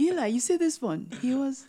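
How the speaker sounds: high-pitched, loud playful telling; mock gossip. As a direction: spill a secret in a squeaky mock voice. Mock telling of Eli's secrets.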